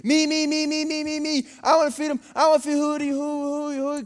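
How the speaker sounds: put-on high, insistent two-year-old chant; imitating toddler speech. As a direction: babble it like an eager toddler, consonants dropping out. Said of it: "she can't really say her D's all the way".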